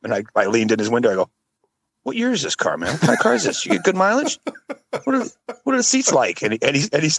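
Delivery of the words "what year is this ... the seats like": high-pitched mock curiosity; joking questions. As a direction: pepper him with teasing questions, voice pitched up. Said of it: "I was just trying to be funny".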